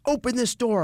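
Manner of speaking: high-pitched retold demand; retold demanding woman. Woman at the deadbolted door, mock demanding. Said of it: "She comes up".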